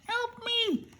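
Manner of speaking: very high, thin cry for help; storyteller voicing a high squeaky Tin Man.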